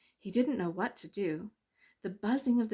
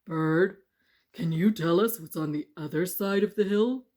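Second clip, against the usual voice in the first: stone voices; 8 dB louder than usual. Acted. Stones asking.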